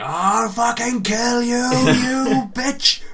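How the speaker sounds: high, loud mock rage, words stretched out; slow-building acted road-rage yell, climbing in pitch; enraged. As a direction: scream threats at another driver, hammed-up. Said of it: "the rage, I was like".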